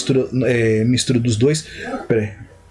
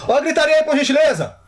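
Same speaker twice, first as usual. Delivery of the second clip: real annoyed call-out. Real, not acted. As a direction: call out sharply over the noise, voice leaping up. Scolding noisy people off mic.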